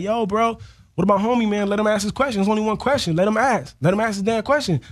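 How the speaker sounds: rally for him from the audience; crowd voice. Retold or imitated. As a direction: audience member backing the rapper. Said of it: "people in the crowd are like".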